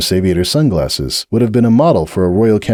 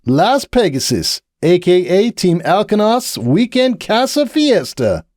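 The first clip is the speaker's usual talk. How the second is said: slow, higher showman's flourish; grand announcement; 11 semitones higher, slower than usual. Acted. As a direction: present the city like a prize. Captain presents the city.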